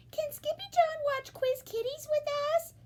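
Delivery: put-on high little-girl voice; a high small sister asking Mama. A narrator's reading. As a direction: ask sweetly. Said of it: "asked Jilly Boo".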